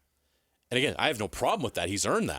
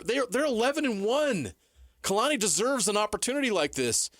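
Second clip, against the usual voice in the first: much higher, pressing each point in a heated rant; worked-up rant; 10 semitones higher than usual. Real, not acted. Host fired up at the coach's double standard, heated and high. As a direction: pitch it up and hammer the argument.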